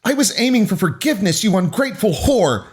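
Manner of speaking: high-pitched outraged cry; shouted. A narrator's reading. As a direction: yell it as an indignant accusation. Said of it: "Haley shouted".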